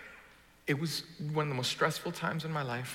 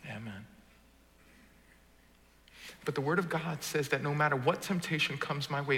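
ordinary talk

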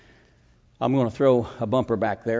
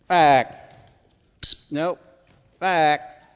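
dog command; 3 semitones higher, 7 dB louder, much slower than usual. Real, not acted. Commanding the retriever.

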